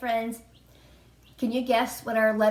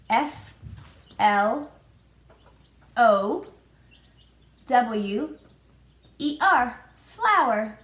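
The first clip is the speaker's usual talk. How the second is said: "F L O W E R" one letter at a time, each a bit louder, then "flower"; spelling out letters; 3 dB louder, much slower than usual. Shown as a demonstration. Teacher spelling flower for preschoolers, loud and clear. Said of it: "That's another F word".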